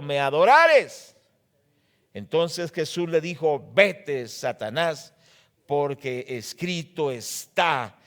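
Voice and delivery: loud, slow preaching of the text; booming sermon reading; fervent scripture reading. Acted. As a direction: proclaim the verses with full force. Preacher reads the temptation scripture.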